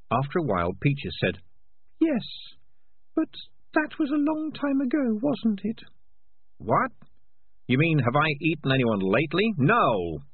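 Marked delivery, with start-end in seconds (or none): 2.0-5.8 very high, thin rat voice; tentative tiny rat, pausing at first; hesitant female rat. Acted. Peaches the rat's put-on voice. 6.6-10.2 higher, louder, affronted cat; exasperated cat character; indignant cat. Acted. Morris reacts indignantly to question.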